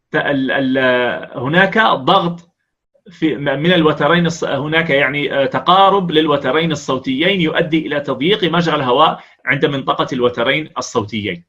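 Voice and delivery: normal talking voice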